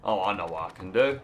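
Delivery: ordinary delivery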